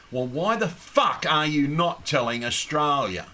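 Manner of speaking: ordinary delivery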